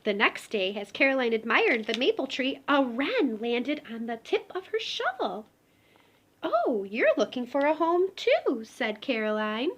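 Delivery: gentle storybook read-aloud; reading to children. Acted. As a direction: tell it like a bedtime picture book. Reading picture book aloud.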